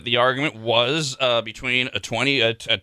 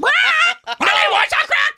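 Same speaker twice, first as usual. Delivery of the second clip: very high, loud, slow squawking parrot; 5 dB louder, slower than usual. Acted. Parrot shouting back at the man, squawking. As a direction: screech it like a parrot. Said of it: "the parrot just repeats".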